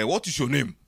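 crisp proper British "What is your name?"; imitating a British accent; British-style English. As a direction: ask it like a polite English schoolboy. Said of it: "O sea, es un poco así".

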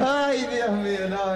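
high, slow laughing cry. Real, not acted. Comedian cracking up at his own joke, laughing high. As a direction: laugh it out, marvelling.

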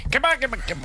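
very high, squeaky cartoonish voice; imitated dubbing voice. Host recalling the guest's old dubbing voices, very high imitation. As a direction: imitate a silly dub up high. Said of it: "Ponías voces como de".

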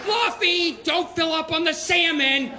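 high-pitched mock pet-owner shout; calling the cat. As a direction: call out to a pampered cat.

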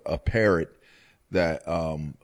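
ordinary talk

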